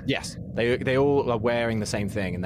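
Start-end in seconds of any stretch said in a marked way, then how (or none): none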